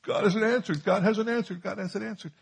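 high, pleading mock believer voice; a hammed-up complaint that God is silent; whining believer. Preacher mocking a believer's complaint, high and whiny. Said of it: "I encounter believers".

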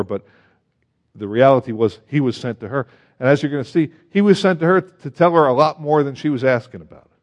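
ordinary talk

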